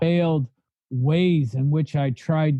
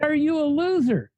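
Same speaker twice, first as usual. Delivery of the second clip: repeated much higher, pushing hard on loser; sponsor pressing the question; 9 semitones higher than usual. Retold or imitated. Sponsor repeating the question to a stunned Cameron, insistent and higher. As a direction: throw it back at him, insistent and higher. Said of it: "He said".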